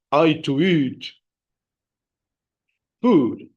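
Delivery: exaggerated, halting pidgin, word by word; Tarzan. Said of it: "talk like Tarzan".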